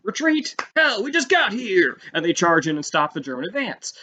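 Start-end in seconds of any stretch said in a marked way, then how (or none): 0.0-2.0 much higher, incredulous and gung-ho; defiant Marines. Retold or imitated. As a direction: scoff at retreat like eager fresh Marines. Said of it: "And the Marines are like".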